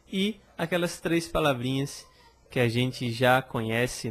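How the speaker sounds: ordinary talk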